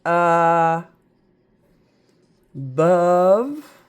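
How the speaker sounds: "bove" said to rhyme with dove and love. Shown as a demonstration. Teacher sounding out a word part for students. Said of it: "like dove and love".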